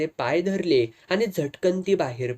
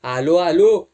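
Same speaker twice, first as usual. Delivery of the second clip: loud call toward the door; answering a knock loudly from inside; 3 semitones higher, 8 dB louder than usual. Acted. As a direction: call out that you're coming. Calling out to door.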